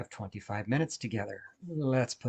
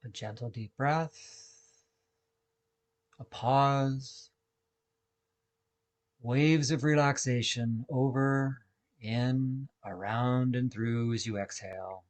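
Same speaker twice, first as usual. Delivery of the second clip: guided meditation. Acted. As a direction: guide listeners gently through each breath, unhurried. Leads a guided breathing meditation.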